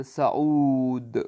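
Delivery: صعود stretched very slowly, sa-uud; teacher modelling صعود after spelling it; slow model word. Shown as a demonstration. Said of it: "عین واو دال".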